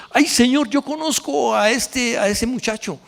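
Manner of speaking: eager, lifted voice pointing someone out; high, happy retelling of a glad discovery; excited recognition. Retold or imitated. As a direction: tell the Lord with glad surprise. Retold joyful meeting in heaven.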